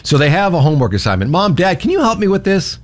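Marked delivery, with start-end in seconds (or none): 1.3-2.8 put-on higher-pitched voice of a kid asking for help; pleading child. Child asking parents for homework help, higher pleading voice. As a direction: play a child calling on both parents.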